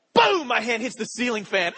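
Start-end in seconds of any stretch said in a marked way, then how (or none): 0.1-0.5 a high, loud sound-effect boom. Retold or imitated. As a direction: slam the boom out. Reenacting hitting the fan.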